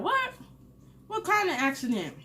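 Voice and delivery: high-pitched imitated disbelief; shocked aunt. Aunt hearing her son had an accident, imitated shock. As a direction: play the aunt's startled, high questioning.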